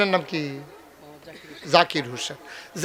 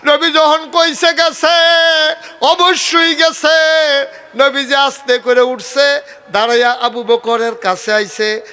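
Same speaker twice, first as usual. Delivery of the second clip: full-force preaching; 10 semitones higher, 7 dB louder than usual. Acted. Preacher telling the crowd the story in a loud, high preaching voice. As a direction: drive every word home to the crowd.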